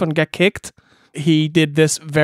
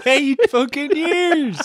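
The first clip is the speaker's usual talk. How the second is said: high, slowed disbelief; incredulous; 8 semitones higher, slower than usual. Real, not acted. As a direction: drag out the disbelief. Incredulous laughing comment.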